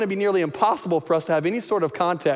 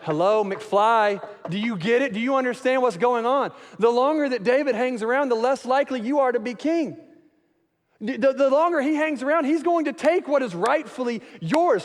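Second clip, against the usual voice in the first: lightly voiced angry king, rising in pitch; exasperated Saul; 6 semitones higher than usual. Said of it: "and he's like".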